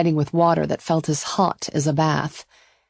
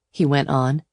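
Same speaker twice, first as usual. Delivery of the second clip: plain reading voice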